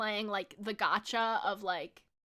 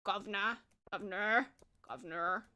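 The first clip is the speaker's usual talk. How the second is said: "governor" stepped down lower and softer; 3 semitones lower, 7 dB quieter than usual. Shown as a demonstration. Streamer practicing an accent tip, "governor" in a lowered tone. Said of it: "Lower the tone".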